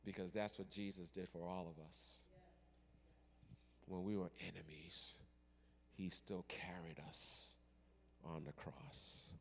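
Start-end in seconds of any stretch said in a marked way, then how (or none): 3.8-9.3 whisper. Real, not acted. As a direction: breathe it out reverently. Sermon climax, whispered.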